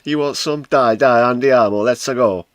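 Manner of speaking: loud, high hammed-up fighting taunt; goading a pretend opponent, loud and high; mock brawl taunt. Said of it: "I'll come downstairs and beat you up".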